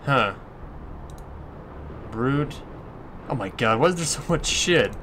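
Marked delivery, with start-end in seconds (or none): none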